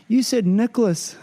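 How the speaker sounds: slow, high teasing Kiwi necklace; mock Kiwi accent. Kiwi couple laughing at his necklace, mock Kiwi accent. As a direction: tease the way necklace sounds. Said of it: "And they went".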